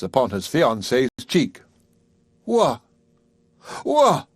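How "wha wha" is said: Middleton choking on shock; stunned exclaim. Acted. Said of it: "exclaimed Middleton".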